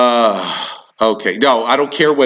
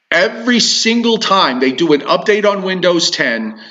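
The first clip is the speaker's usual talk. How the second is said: ordinary delivery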